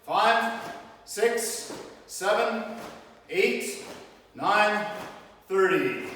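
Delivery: loud, sharp drill counts; counting out loud. Real, not acted. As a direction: bark each count for the punches. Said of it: "We're punching by my count".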